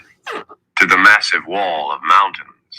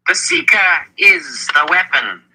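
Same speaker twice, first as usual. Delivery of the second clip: "The Seeker is the weapon" high, slow and emphatic; said with emphasis; 11 semitones higher, slower than usual. A narrator's reading. Zed instructing Richard, "The Seeker is the weapon" with slow, high emphasis. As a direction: weigh out "The Seeker is the weapon" slowly, pitched high. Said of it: "Zed said with emphasis".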